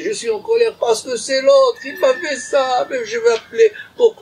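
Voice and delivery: self-pitying voice that climbs into a whimper; whiny victim. Pretend. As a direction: play the wronged one blaming the other.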